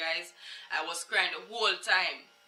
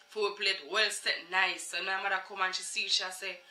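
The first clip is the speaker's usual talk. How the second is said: ordinary talk, nothing marked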